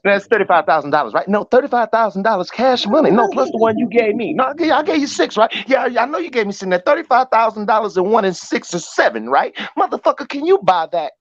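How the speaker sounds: high, excited mock pimp tallying money; hustler counting cash. Friend mocks a pimp from The Mack, pitched high.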